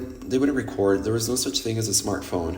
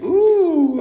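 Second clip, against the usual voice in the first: long, high, loud "ooh"; delighted "ooh"; 8 dB louder than usual. Retold or imitated. Retelling people throwing money on stage, loud high delighted ooh. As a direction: squeal a held, high "ooh".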